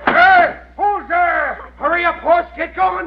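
loud high calls into the dark; calling out. Acted. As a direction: yell after the runaway horse. Shouting in dark at horse.

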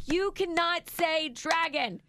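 high, slow, emphatic mock reprimand; imitated mom laying down the law; retold scolding. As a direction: spell out the rule, exasperated.

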